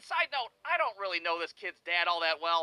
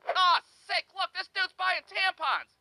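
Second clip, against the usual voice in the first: mocking onlooker; 11 semitones higher, 4 dB louder, slower than usual.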